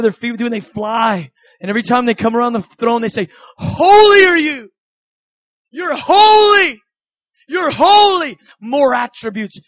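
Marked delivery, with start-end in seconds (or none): 3.6-8.4 high, loud cries of worship with long-drawn words; awed proclamation. Acted. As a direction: proclaim it like an overwhelmed heavenly creature. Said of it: "they begin to proclaim".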